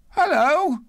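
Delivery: put-on very high boyish reply; young boy. A narrator's reading. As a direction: call back as a young lad. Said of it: "returned the boy".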